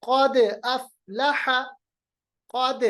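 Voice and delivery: क़द with d bounced as "qade"; qalqalah. Shown as a demonstration. Teacher shows dal example, क़द with springing d. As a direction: echo the d of क़द, slow अफ़लह. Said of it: "देखिए क़द नहीं पढ़ा मैंने".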